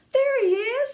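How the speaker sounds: very high, loud excited shout; high pointing-out cry. A narrator's reading. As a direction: shout as you spot him. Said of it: "somebody shouted".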